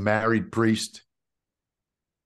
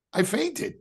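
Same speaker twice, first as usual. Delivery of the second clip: incredulous. Retold or imitated. Shocked realization of fainting.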